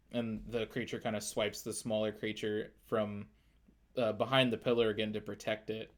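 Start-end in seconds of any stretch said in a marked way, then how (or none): none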